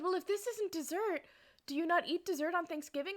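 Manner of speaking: high, emphatic bafflement; incredulous question. Retold or imitated. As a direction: act out a family struggling to understand.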